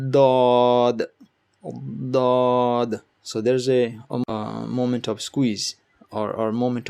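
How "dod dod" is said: dod pressed then burst out loud; forceful dod. Shown as a demonstration. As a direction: squeeze and release dod. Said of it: "and now let it out".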